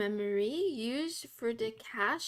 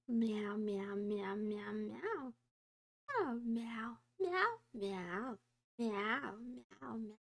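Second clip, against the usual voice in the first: softer meows fading to a breath; whispered kitty meows; 5 dB quieter, slower than usual. Pretend. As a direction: meow quietly like a sleepy cat. Streamer meowing like a cat.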